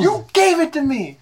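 high-pitched insistent comeback; squabbling retort. Real, not acted. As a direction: argue back, voice up high.

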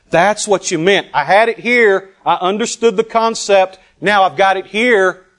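high, loud breakthrough; ringing, emphatic climax of discovery; impassioned realization. Retold or imitated. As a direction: proclaim it as it finally hits home.

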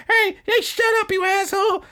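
high, loud shrill insult; cussing out. Retold or imitated. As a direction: screech back at him. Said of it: "And she's like".

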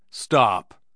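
short, loud warning shout; sharp, alert command. A narrator's reading. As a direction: shout a sudden halt. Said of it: "he shouted".

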